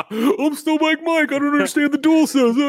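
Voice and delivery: mock a whiny complainer in a high voice; Snowbike Mike. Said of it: "Snowbike Mike".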